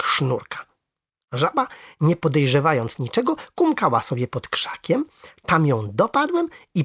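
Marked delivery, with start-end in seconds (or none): none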